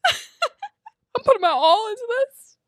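high, slow laughing protest; dramatic protest. Retold or imitated. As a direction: plead it high and drawn out, half laughing. Mock protest about her singing.

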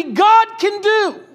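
high, loud, drawn-out cry; climactic cry. Real, not acted. Preacher exalting God's work, slow and loud. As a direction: stretch it out, ringing.

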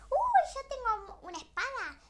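high, loud and laughing; excited. Acted. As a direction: burst out with excitement. Princess excited about sword.

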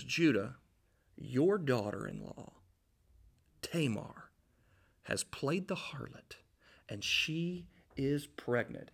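a gossip's hushed report, slowing at the end; sneaky gossip. Retold or imitated. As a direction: lean in and spill the secret quietly. Informer sneaking up to Judah.

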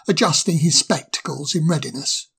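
plain reading voice

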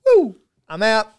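high, loud victory whoop; mock triumph. Co-host guessed right and quits on top, mock triumph. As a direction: celebrate like you just won it all.

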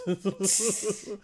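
high laugh in a short burst. Real, not acted. Man laughing at his own joke about loving her, high laugh.

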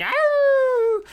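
high loud wolf howl. Acted. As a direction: throw back the head and howl. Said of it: "He just howls".

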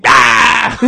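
loud high shriek; mock shriek. Comedian shrieks as a tiny figure jumps out. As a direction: yelp like a tiny man bursting out.

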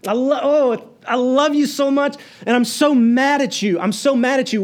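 high, loud, anguished pleading voice; loving yet angry. Retold or imitated. Pastor voicing a grieving parent to a wayward child, high anguished cry. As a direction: torn between love and anger, voice cracking high. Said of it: "You ever been like that?".

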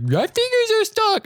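very high, slow goofy cry; hammed-up mock wail. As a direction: overplay the trapped-fingers panic. Repeats slapstick cry, more overblown.

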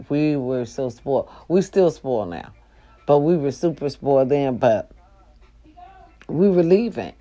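normal talking voice

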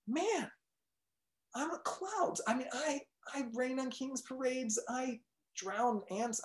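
high, soft and defeated; deflated cloud. Acted. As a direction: sigh it out, beaten.

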